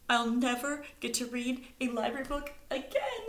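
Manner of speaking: high whine with a laugh in it; whining. A narrator's reading. Stella whining to the scouts, mock whine. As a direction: complain like a child. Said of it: "whined Stella".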